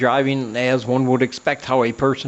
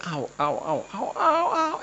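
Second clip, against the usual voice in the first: high yelping ows, rising sharply; imitating her crying out in pain; mock cries of pain; 5 dB quieter than usual. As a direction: yelp it like sudden pain. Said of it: "And she just started crying".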